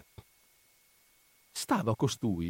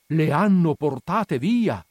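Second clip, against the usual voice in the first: louder, slow lament; dismayed outcry; 5 semitones higher, 6 dB louder, slower than usual. Acted. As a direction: cry the news to everyone. Repeated as an outcry.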